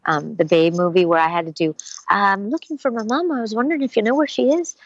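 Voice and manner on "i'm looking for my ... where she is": put-on high, light Irish-accented little voice; a lost young animal asking in an Irish lilt; sweet Irish accent, a bit quick. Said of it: "a little bit of an Irish lilt when I did Babe".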